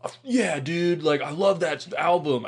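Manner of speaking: high, drawn-out gushing dad; enthusiastic dad. Retold or imitated. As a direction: gush like a dad claiming he loves the band. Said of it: "bunch of dads will just be like".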